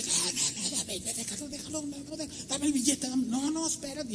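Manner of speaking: rushed, hushed-down mock protest; overlapping little pleas, played small; acted protest. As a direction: imitate a frantic tug-of-war without volume. Struggle between the couple, acted out.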